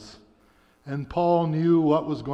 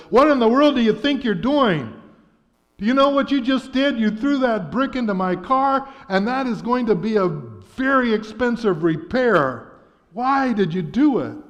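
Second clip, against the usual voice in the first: put-on much higher, louder furious yelling at a kid; angry shout; 8 semitones higher, 6 dB louder than usual. A narrator's reading. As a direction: blast him for wrecking the new Jaguar. Executive yells at brick thrower.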